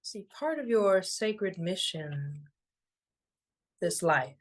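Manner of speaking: unhurried, soothing and evenly paced; guided meditation. Acted. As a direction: guide them gently into stillness, phrase by phrase.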